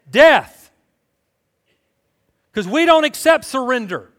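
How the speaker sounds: high, loud, emphatic preaching; forceful preaching. Real, not acted. Preacher challenging proud Americans to learn surrender, forceful preaching. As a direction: drive each point home at full voice.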